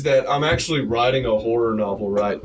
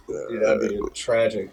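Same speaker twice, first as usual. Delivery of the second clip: usual speaking voice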